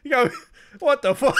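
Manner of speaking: loud, high, laughing protest; laughing disbelief. Retold or imitated. Stopping the teacher mid-story, loud and laughing. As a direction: stop her, cracking up. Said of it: "I was like".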